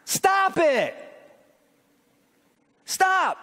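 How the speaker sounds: high, sharp preacher's command; real pulpit admonishment, pitched high; forceful rebuke. Real, not acted.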